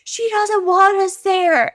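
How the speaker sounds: put-on high, loud child's shout; imitated kid yelling at a sibling; King. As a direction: holler protectively like a small boy. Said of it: "I hear King going".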